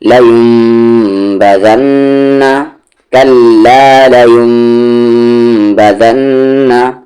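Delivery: बजन्ना with the doubled noon held and humming through the nose; nasal ghunna. Shown as a demonstration. Teacher demonstrating ghunna on the doubled noon, slow. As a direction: hold the ghunna on बजन्ना for two beats. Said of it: "तशदीद है तो गुन्ना भी होगा".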